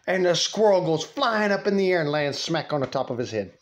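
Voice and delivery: ordinary talk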